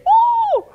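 high-pitched "woo" cheer; short celebratory high whoop. Pretend. Cheering after announcing work day.

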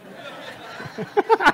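a sudden high laugh bursting out; high breathy laugh. Real, not acted. Preacher laughing at his own joke to the congregation, high burst of laughter.

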